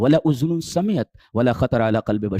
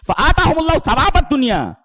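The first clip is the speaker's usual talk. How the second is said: high, loud chanted verse; raised Quranic recitation; 6 dB louder than usual. Acted. Preacher reciting the verse on reward, loud and high. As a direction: ring the verse out.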